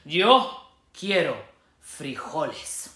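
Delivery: slow, a touch of solemn; somber declaration. Acted. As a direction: declare it gravely. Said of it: "in his most somber voice".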